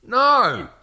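high, loud shout of no; piercing cry of no. Retold or imitated. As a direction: yell it out in disgust. Outburst at bed-wetting.

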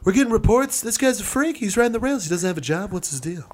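high-pitched speech-giver railing at a guy; hammed-up rally complaint, starting high and sinking; Trump. Politician complains about train guy at a speech, mock rally voice. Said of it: "So Trump is up there like".

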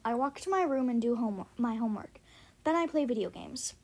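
ordinary delivery, nothing marked

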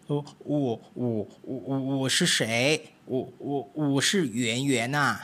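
stammering cat voice, caught off guard; flustered fat cat. Acted. Fat cat stammering out his name to the mice, flustered. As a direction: stumble over the words like a caught cat.

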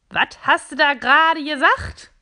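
the offended cup demands an answer; angry cup. Acted. Cup angrily confronting the robot for the insult. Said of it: "fragt die Tasse zornig".

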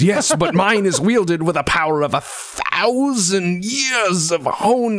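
slow, rising, theatrical boast; grandiose wizard boast. Acted. Wizard boasting to the party of psychic power, slow and theatrical. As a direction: stretch it out like an overblown mystic. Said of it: "He goes".

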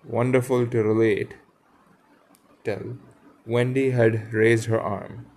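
clear, projected read-aloud with lightly acted dialogue; story narration. A narrator's reading. Reader telling of the boys and the fallen lady, storytelling voice.

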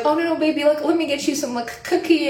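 high cooing kind lady; imitated coddling. Lady offering a cookie to upset customer, imitated coddling. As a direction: imitate someone fussing over a hurt child.

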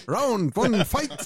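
high, loud arena announcer call; booming fighting game announcer. Pretend. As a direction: boom it out like a fight game intro. Mock fighting game round start.

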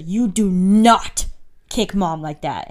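slow, loud, drawn-out "not" from an angry mother; imitated stern mom scolding. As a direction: lay down the law like a mom. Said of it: "[name] do mom voice".

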